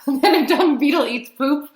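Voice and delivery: giggling through the words; laughing. Real, not acted. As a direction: laugh at the silly fact. Teacher laughing at dung beetle.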